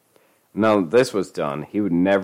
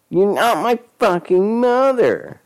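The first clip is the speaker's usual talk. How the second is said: high, slow furious retort; snapping back; 13 semitones higher, 5 dB louder, slower than usual. Acted. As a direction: shoot it back angrily. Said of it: "he shot back".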